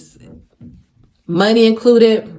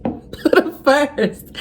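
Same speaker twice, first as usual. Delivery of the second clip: laughing, slow, high; 6 semitones higher, much slower than usual. Shown as a demonstration. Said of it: "Put him first in everything".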